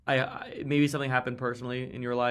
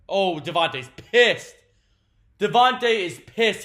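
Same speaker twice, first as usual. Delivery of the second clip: higher, louder, slowed call; excited announcing; 6 semitones higher, 10 dB louder, slower than usual. Real, not acted. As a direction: announce it like breaking news. Announcing player's outburst.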